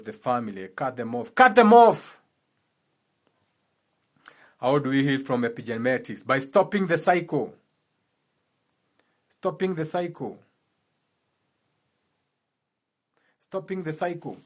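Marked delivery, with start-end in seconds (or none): 1.3-2.2 louder and higher, a hard shout; loud command. Shown as a demonstration. To family ties, now shouted. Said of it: "repeated command, second louder". 9.3-14.4 softer each time, falling away; fading repeat. Shown as a demonstration. Repeating the cure, growing softer. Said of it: "repeated, fading softer".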